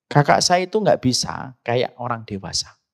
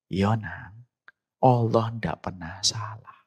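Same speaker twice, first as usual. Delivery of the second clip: softer, breathless whisper from a hospital bed; a frail old father whispering back to his son; faint whisper of a sick father; 2 semitones lower, 6 dB quieter, slower than usual. Retold or imitated. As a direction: barely get the words out, weak and resigned.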